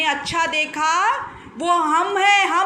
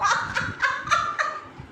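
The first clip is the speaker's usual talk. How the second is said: high breathy laugh; 9 semitones higher, 5 dB quieter than usual. Real, not acted.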